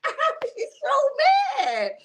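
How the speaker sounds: high, slow wail breaking into laughter; laughing outrage. Real, not acted. Viewer admitting how mad the show makes them, laughing wail. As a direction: drag it out in a laughing squeal. Cracks up over own mock outrage.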